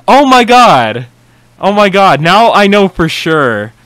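loud, high gasp of realization; real stunned oh my god; shocked realization. Real, not acted. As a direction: blurt it out as it clicks. Sudden realization reacting to video.

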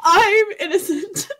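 laughing through a protest. Real, not acted. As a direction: giggle out the denial. Laughing denial.

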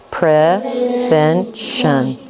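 very slow, stretched syllable by syllable; slow chunked. Shown as a demonstration. Teacher models the word for students, very slowly. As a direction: sound out each chunk slowly. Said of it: "Say it again".

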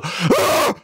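high strained gasping ah; mock heart-attack cry. Man faking a heart-attack cry so his wife wakes, high. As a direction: gasp out a cry as if clutching the chest. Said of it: "she'll hear me go".

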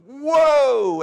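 big, high, loud whoa sliding down; imitated skid. Station wagon's back end goes out, loud swooping whoa. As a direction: voice the car's back end swinging out. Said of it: "because that back end goes".

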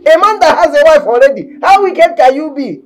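high, loud, slow tirade; loud jealous rant; jealous outburst. Acted. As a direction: rage at her with jealousy.

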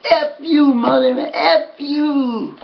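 high mock scream, held back in volume; imitated yell. Enraged rival yells back at the winner, mock screaming. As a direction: curse in a strained, high voice. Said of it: "when he grabbed me and yelled".

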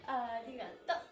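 ordinary talk, nothing marked

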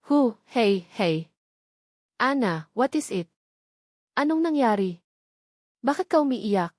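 a touch of alarm, gently voiced; deep concern. Marco hearing Ana cry on the phone, worried. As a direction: soothe her while worry rises. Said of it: "napuno ng matinding pag-aalala".

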